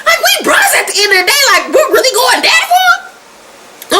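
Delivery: very high, hammed-up wail; mock crying. Roller crying to his brother over the fight, mock wail. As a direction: whine it out in fake sobs, half laughing. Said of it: "Roller start crying like".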